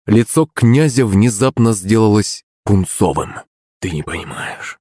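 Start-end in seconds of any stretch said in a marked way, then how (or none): 3.8-4.8 low, hushed husky reply; hoarse and subdued. A narrator's reading. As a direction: answer softly, as if your voice has gone. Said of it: "осипшим голосом ответил он".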